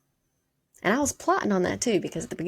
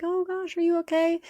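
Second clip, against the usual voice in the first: much higher, sugary mock-caring voice; mock concerned bystander; 11 semitones higher than usual. Mocking how a nice person would ask the fallen boy, high and sweet. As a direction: play the fake-sweet nice stranger.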